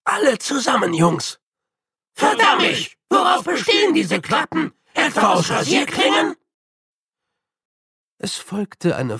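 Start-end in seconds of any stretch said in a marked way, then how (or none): 0.0-1.4 calling out. A narrator's reading. Calling the others to join. 2.1-6.4 high, loud pained outburst; a man shouting in pain after the slot slices his glove; cursing shout. A narrator's reading. Cut hand in the flap.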